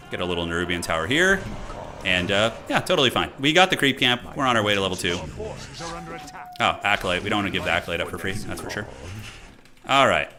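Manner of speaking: ordinary talk, nothing marked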